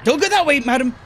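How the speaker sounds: high urgent shout; loud warning. Real, not acted. Warning a fleeing teammate.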